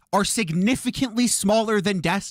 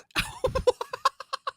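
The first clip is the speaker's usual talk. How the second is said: disbelieving laugh; 4 dB quieter than usual. Real, not acted. Streamer laughing at clip.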